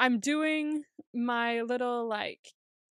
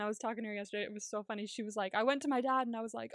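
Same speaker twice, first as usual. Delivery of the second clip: ordinary talk, nothing marked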